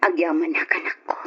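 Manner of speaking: put-on whispered tenderly to her children; mother whispering goodbye to her children. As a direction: breathe the words without voice. Mother's soft farewell to her children.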